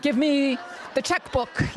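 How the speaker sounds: slow, self-satisfied mock husband voice; lightly voiced husband, drawn out and pleased with himself; mimicking the sober husband's slow demand; smug newly sober husband. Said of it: "they go from being the scum of the earth to".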